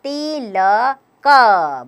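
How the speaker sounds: ति ल क said very slowly, each syllable separate. Shown as a demonstration. Teacher joining sounds for pupils, very slow syllables. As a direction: spell ति ल क out with gaps between sounds. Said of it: "त में लगाइए छोटी की मात्रा".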